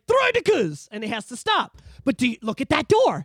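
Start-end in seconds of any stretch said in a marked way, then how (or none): 0.1-0.9 alarmed call. Retold or imitated. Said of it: "and they're like".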